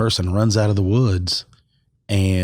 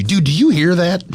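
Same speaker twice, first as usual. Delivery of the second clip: high, animated pretend panic; mock alarm; 9 semitones higher, 4 dB louder than usual. Imagining a text to a friend about drumming, mock alarm. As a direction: blurt it out in playful alarm.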